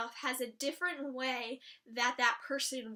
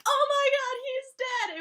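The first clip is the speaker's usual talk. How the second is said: high loud alarmed cry; mock alarmed shriek; 10 semitones higher, 8 dB louder than usual. Imagined frantic reaction to Dean dying, shrieked. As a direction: play the panic the show skipped. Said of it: "It wasn't like".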